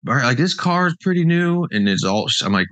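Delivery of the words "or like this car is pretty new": high-voiced imitation of a puzzled old lady; nice old lady. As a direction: pitch it well up, imitating an older woman. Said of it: "she's like".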